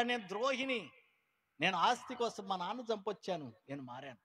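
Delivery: loud, high confessions of the converted; fervent testimony. Retold or imitated. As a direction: proclaim each confession with fervour. Church members' testimonies voiced. (ఉత్సాహంగా సాక్ష్యం)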